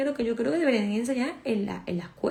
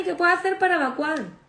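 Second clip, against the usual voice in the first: higher, eager client asking; imitated client; 8 semitones higher, 4 dB louder than usual. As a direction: play the patient begging for a fix. Voicing a client's question. (paciente imitada, voz más aguda)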